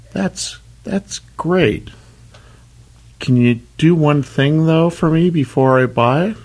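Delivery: high, slow little snail voice; a timid snail asking the car dealer a favour, high-pitched. Acted. Snail customer in joke.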